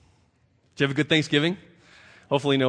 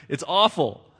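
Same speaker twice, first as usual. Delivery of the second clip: crying out; 5 semitones higher, 5 dB louder than usual. A narrator's reading. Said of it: "he cried".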